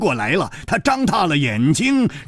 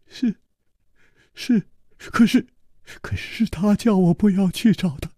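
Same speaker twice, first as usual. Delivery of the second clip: a hint of a higher, hesitant young voice; lightly voiced servant; 5 semitones higher than usual. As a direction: answer timidly. (怯生生的仆人)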